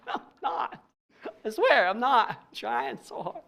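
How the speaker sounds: giggling denial; amused, breathy protest through laughter; laughing protest. Real, not acted. Laughing protest to his trainer.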